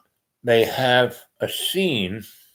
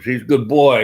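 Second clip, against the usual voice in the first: louder, fond praise for the dog; doting dog praise; 3 semitones higher, 7 dB louder than usual. Real, not acted.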